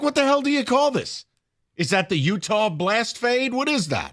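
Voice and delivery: mock incredulous. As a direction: ask it like a baffled heckler. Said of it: "Just be like".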